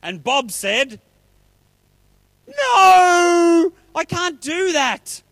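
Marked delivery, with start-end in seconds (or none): 2.5-5.2 high, loud, long-held wail; melodramatic refusal. Acted. Bob refusing to pay Fred back, melodramatic refusal. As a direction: cry out in hammed-up horror, stretched.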